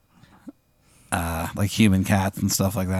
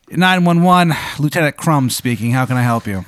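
a hint of a brisk dispatcher, higher and louder at first; police lieutenant; 5 semitones higher, 3 dB louder than usual. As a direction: answer the call like a cheery cop.

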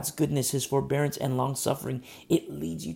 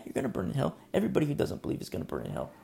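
usual speaking voice

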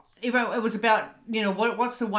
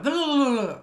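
high, rapid gibberish blur; super-fast babble; 5 semitones higher, 5 dB louder than usual. Retold or imitated. Speaker mimicking her nervous speech on the call, high babble. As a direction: rattle it off as one rolling jumble. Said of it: "I think I spoke like".

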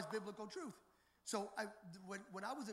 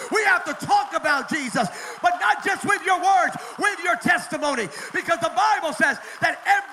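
loud, high, shouted sermon climax; bellowing the name of Jesus to the congregation; full-force preaching; 6 semitones higher, 20 dB louder than usual. Acted. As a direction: preach it to the rafters, hammering every line.

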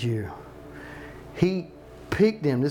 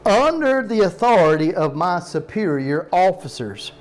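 "under the authority" punched out firmly; emphatic underlined reading; 2 semitones higher, 4 dB louder than usual. Shown as a demonstration. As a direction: lean hard on "under" and "authority", then ease through "superior officers". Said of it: "we'll look at the underline".

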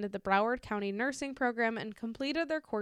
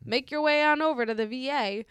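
casual clerk voice; 5 semitones higher, 7 dB louder than usual. Retold or imitated. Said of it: "they were like".

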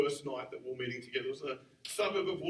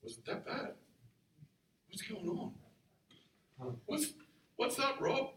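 soft, higher, hesitant questions with long gaps; awkward asking; 6 semitones higher, 4 dB quieter than usual. Retold or imitated. Awkward young man asking his broken-down pastor friend, tentatively. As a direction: tread gently, halting and unsure. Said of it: "I'm kinda awkwardly standing next to him going".

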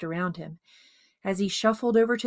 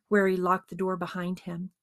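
usual speaking voice

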